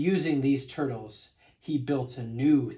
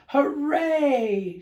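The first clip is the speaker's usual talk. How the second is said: much higher, loud joyful shout; shouted hooray; 12 semitones higher, 8 dB louder than usual. Acted. Yertle seeing forty miles, loud high cheer. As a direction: whoop with delight from the top. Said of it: "shouted Yertle".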